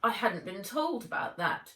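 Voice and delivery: miffed, disdainful aunt; aunt put out, disdainful; sniffy aside. A narrator's reading. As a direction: sniff it out in offence. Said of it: "she sniffed".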